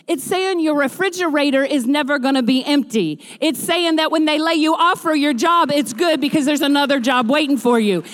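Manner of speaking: high, loud, building preacher's cadence; preaching. Acted. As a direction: preach it at full force, rising.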